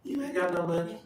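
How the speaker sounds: high-pitched comic inner voice; sudden nagging thought, voiced high; mock realization. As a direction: pitch it up for the punchline.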